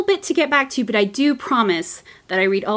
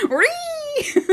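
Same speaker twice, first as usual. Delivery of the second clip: 1 [name]-like screech. Real, not acted. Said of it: "I kinda sounded like [name] there".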